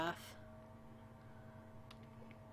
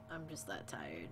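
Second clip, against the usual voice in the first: soft, low, exhausted whisper; tired whisper; 12 semitones lower, 10 dB quieter than usual. Real, not acted. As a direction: let it fade into a sleepy whisper.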